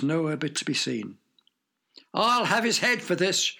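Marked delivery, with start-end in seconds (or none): 2.1-3.5 a touch of a roaring king; roared. Acted. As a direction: roar it out lightly, angry king. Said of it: "roared the king".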